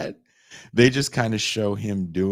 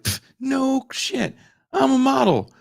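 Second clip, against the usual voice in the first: high, scoffing "no shit, I'm a model"; sassy retort; 4 dB louder than usual. Retold or imitated. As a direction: scoff like it's obvious. Voicing the woman's retort.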